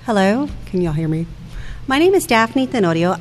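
usual speaking voice

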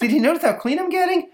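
put-on boy begging mom for praise; cheeky little boy.

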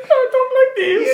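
very high, slow, laughing posh British voice; mock posh British. Shown as a demonstration. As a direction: ham up the posh British accent while cracking up. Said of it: "No one talks like that".

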